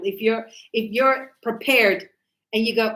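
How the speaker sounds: ordinary delivery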